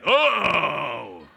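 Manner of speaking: long, loud mock bellow; big-man scream. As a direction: yell like a big man in fright. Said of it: "they scream like big men".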